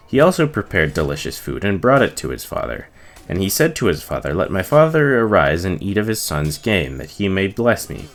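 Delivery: usual speaking voice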